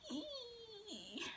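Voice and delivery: tiny, very high, soft squeak of dismay; strained high squeak. Retold or imitated. Girl watching another girl jump on her crush, a high strained squeak. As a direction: squeak out a pained little "ee".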